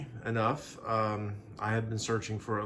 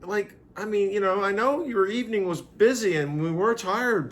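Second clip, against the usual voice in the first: mock sympathy; 9 semitones higher, 7 dB louder than usual. Teasing excuse about forgotten proposal.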